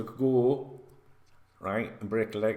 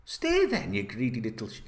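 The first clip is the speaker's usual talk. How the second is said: exasperated retort. Real, not acted. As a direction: fling it back at him, fed up. Mocking the player's fake loyalty.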